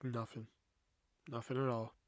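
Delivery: retold tight-lipped, withdrawn murmur; sullen denial. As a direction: shut the question down under your breath. Retells sullen denial while mad.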